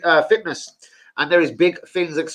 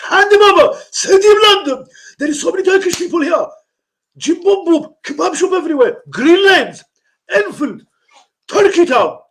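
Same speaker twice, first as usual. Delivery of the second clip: high, loud put-on Turkish accent, hammed up; a high mock Turkish accent demanding the boy; 14 semitones higher, 5 dB louder than usual. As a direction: play a pushy Turkish uncle in a mock Turkish accent. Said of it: "Turkish Jim Bob".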